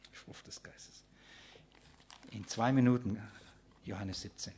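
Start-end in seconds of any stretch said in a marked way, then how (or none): 0.1-1.0 breathed whisper, barely audible. Acted. Preacher whispering the phrase to the listeners. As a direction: whisper it like something precious. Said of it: "whispered phrase".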